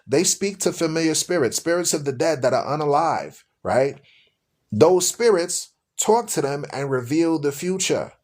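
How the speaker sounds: ordinary delivery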